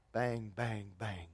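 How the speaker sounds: soft, low knocks on the gate; knocking on the door. Shown as a demonstration. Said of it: "it'd be like this".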